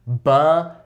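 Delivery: "Butter" in two separated beats with a hard D; exaggerated D sound. Shown as a demonstration. Viewers repeat along, exaggerated D in "Butter". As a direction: split "Butter" as buh, DER for repeating. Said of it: "say that word with me".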